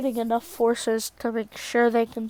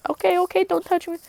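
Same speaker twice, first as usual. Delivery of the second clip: high, hurried-sounding mock protest; high-pitched put-on surrender; acted backing off; 7 semitones higher, much faster than usual. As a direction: fend them off in a raised voice.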